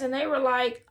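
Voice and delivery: ordinary talk, nothing marked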